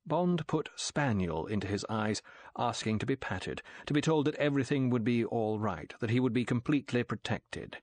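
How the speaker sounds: ordinary delivery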